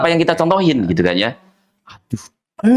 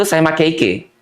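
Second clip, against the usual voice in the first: Kelantan dialect: saya make ike, final n dropped; Kelantan accent; 2 semitones higher, much slower than usual. Shown as a demonstration. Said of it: "akhiran N itu, dia jadi hilang". (logat Kelantan: make ike tanpa n)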